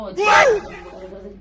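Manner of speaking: loud, high, very slow stretched shout; drawn-out yell. Real, not acted. Fed up with her husband, a long stretched shout. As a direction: stretch every syllable into a yell.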